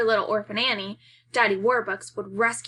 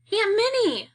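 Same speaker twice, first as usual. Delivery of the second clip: much higher and louder call; crying out; 12 semitones higher, 5 dB louder than usual. Acted. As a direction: cry her name out in shock, much higher and louder. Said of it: "I cry".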